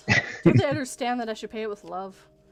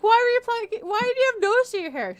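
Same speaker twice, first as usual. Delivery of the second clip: put-on much higher child voice; kid voice; 11 semitones higher, 4 dB louder, faster than usual. Bluey quizzing dad about nose hair, high child. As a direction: ask it like a baffled little girl. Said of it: "She's like".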